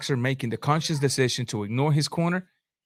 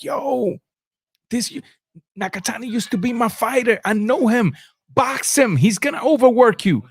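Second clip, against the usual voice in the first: higher, mock cornerman urging his fighter; corner pleading; 6 semitones higher than usual. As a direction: play the trainer begging him to box. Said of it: "But the corner was like".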